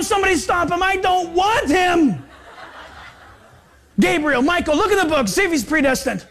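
panicked mock God, shouting, laughing; mocking a reluctant God. Preacher mocking a God who doesn't want the convert, in a frantic shout. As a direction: ham up a frantic God refusing a sinner. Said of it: "He doesn't go".

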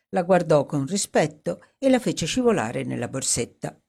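usual speaking voice

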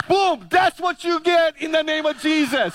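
high, loud, gloating proclamation; mock triumphant shout. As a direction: ham up a victorious preacher's cry. The preacher mock-triumphs over the heckler.

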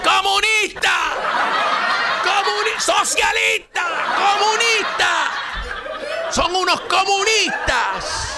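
high, hammed-up shouting of "comunista"; mock outraged shout. Commentator mocking opponents' hysterical shouting. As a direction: parody the outraged partisan yelling. Mocking Trump supporters' outcry.

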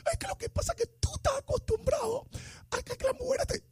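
squeaky, high whining woman's voice; whiny girlfriend. Pretend. Host voices girlfriend complaining he never texts, high whine. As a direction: play the clingy girl who feels ignored. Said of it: "whiny woman voice".

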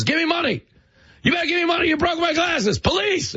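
very high, shouted mock shakedown; hustler's demand. Hustler demanding money from a nurse, very high shouting whine. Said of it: "drops his glasses and goes".

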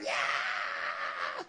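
long, drawn-out demon yell; hollered demon cry. Retold or imitated. Spirit leaving the man cries out, long holler. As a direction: holler out one sustained cry. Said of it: "he hollered out".

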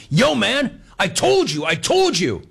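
high-pitched pushy imitation; aggressive talker. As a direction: crow it like the pushy gambler. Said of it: "really aggressively".

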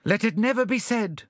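high, ringing opening declamation; rally them like an orator; grand rousing speech; fine oratory. A narrator's reading. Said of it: "exert his finest oratory".

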